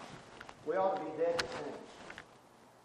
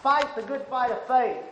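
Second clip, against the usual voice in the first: loud exhortation; 9 semitones higher, 13 dB louder, slower than usual. Acted. Shouted exhortation in sermon.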